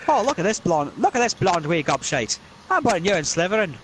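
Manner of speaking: high, jeering Scots voice; mocking Scottish hat. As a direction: sneer at the blonde boy. Sorting Hat insulting Malfoy.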